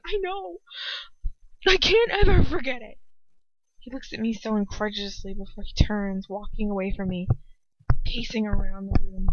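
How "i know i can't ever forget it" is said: high, anguished outburst; desperate. A narrator's reading. Hermione telling Ron she can't forget, desperate. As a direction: burst out in desperation. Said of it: "I gasp in desperation".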